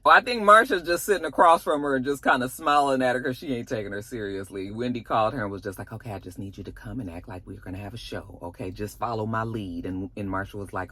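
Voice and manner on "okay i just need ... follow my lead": softer, fast hushed instructions; quick soft secret plan; conspiratorial aside. Retold or imitated. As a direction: tell her the plan under your breath. Wendy secretly instructing Marsha.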